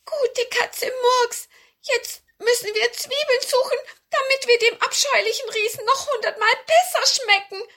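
very high, loud dwarf voice; squeaky, agitated little dwarf. Acted. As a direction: pipe up like a tiny dwarf. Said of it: "der Zwerg sagte".

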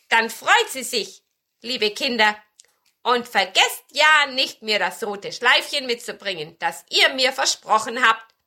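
lauter, leicht gespielter Besen; mahnender alter Besen. A narrator's reading. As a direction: bitte die Kinder mit Nachdruck. Spielt den alten Besen. (leicht gespielte Besenstimme, nachdrücklich)

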